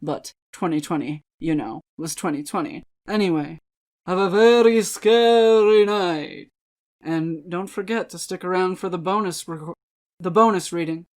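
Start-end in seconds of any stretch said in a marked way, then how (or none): 4.1-6.5 slow, louder mock-spooky wish; spooky farewell. Host wishes viewers a scary night, mock-spooky. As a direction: ham up a creepy goodnight.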